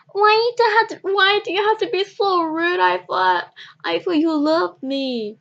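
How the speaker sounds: high, loud, tearful wail; crying plea. Retold or imitated. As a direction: cry it out like a hurt kid begging. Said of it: "when I crying lying down".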